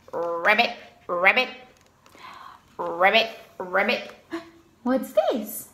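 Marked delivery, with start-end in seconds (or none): 0.1-4.1 frog croak. Pretend. Frog sound before frog revealed.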